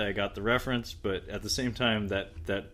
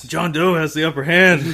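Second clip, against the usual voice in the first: higher and louder, words dissolving into laughter; laughing; 8 semitones higher, 13 dB louder than usual. Real, not acted. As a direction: blurt it out and crack up. Laughing at the movie reference.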